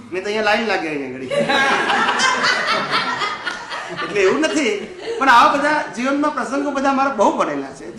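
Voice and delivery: laughing. Real, not acted. Joking about people queueing at his door, laughing. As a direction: laugh as you rush through it.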